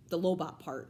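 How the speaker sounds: ordinary delivery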